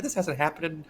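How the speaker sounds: high, dismayed mock panic; retold incredulity. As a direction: replay the disbelief, pitch climbing as it goes. Acting out his panicked disbelief.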